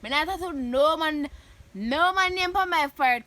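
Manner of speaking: ordinary delivery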